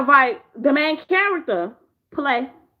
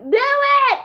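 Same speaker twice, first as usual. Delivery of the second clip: much higher and louder, slow, goading shout; taunting yell; 11 semitones higher, 3 dB louder, slower than usual. Retold or imitated. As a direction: egg her on, yelling. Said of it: "was like".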